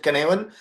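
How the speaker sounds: Cynefin said "kuh-NEV-in", with a hard k; Welsh pronunciation. Shown as a demonstration. Colleague correcting how the model is pronounced, Welsh pronunciation. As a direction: give Cynefin its Welsh sound, stress in the middle. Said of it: "that's the Welsh pronunciation".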